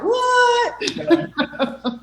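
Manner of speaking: high, drawn-out "what"; mock surprised. Teacher imitating everyone reacting to the stray text, shocked. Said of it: "they're all like".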